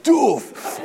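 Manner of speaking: sudden high "doof" thud sound; imitated impact noise. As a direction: voice the blow like a cartoon punch. Imitating a blow to the guts.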